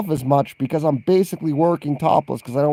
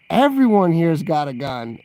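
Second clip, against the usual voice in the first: normal talking voice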